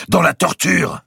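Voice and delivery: high, loud cry; defiant retort. A narrator's reading. Grand master answers his accuser, defiant retort. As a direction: fire it straight back.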